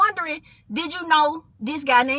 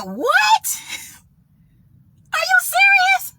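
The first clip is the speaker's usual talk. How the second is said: high-pitched shocked disbelief; acted-out astonishment, pitched way up; incredulous; 14 semitones higher, slower than usual. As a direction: question it in a high, stunned voice. Shocked reply to the voice.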